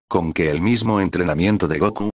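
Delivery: ordinary delivery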